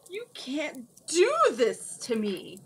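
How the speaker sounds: slow, breathless panicked cry; shrieking whisper. A narrator's reading. Melting wizard cries out to Cimmerian in panic. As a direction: horror as he melts. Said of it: "he shrieked".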